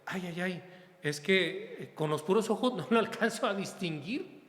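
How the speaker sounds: acted bafflement, pitch climbing high at the end; puzzled self-imitation. Priest can't place a masked greeter, acted puzzlement. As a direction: play flustered at not knowing who it is. Acts out failing to recognise greeter.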